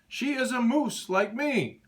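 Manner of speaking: high, slow yell. Acted. Morris yells back at the deer, high and slow. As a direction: insist it, raising the voice. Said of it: "Morris yelled".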